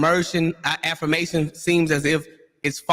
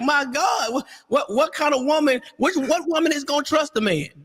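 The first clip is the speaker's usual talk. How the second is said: exasperated man; 9 semitones higher than usual. Retold or imitated. Man voicing his past trauma.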